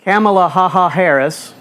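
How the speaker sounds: ordinary talk, nothing marked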